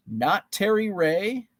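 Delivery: usual speaking voice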